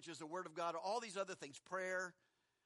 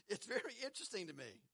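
normal talking voice

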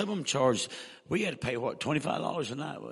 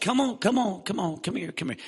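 higher, louder coaxing call; coaxing the dog; 7 semitones higher, 4 dB louder than usual. Retold or imitated. Evangelist coaxing his dog in the motel hall, raised coaxing call. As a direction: urge the dog to come back. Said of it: "And I said".

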